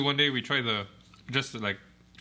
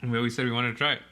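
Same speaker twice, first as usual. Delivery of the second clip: talking while chewing jerky; words around a mouthful of food; mouth full; 2 semitones lower, faster than usual. Real, not acted. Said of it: "I'm talking and eating this is kinda hard".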